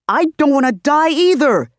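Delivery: high and loud reply; heated retort. Acted. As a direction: snap back in protest.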